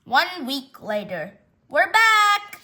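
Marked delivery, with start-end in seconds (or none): 1.7-2.6 high, loud sing-out; called-out homecoming. Acted. Owners home after one week, loud high call. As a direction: holler it through the house as you arrive.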